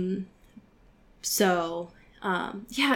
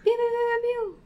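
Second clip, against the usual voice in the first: high pew sound effects; 14 semitones higher, faster than usual. Pretend. Sound effects after patron thanks.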